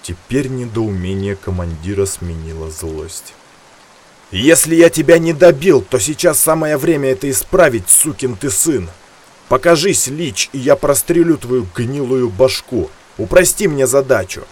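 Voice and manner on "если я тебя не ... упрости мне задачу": loud, high angry yell; furious shout. Acted. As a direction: bellow threats in a rage. Commander yells threats at Lich.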